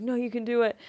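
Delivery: high, loud imitation of an eager friend; insistent encouragement. Streamer imitating Juan urging a Spanish accent, eagerly insistent.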